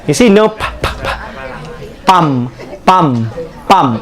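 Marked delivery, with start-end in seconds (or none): 2.0-4.0 "pum" with the final p cut off, said low; clipped pump demo. Shown as a demonstration. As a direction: model "pum" with no end p. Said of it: "It's pump".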